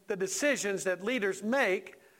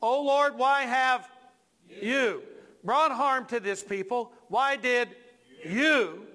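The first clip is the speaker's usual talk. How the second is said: loud, raised accusation, pausing before each "you"; ticked-off Moses; 4 semitones higher, 4 dB louder, slower than usual. Acted. Preacher as Moses blaming the Lord, ticked off. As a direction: set "you" apart and hit it hard. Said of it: "you're, you're ticked off".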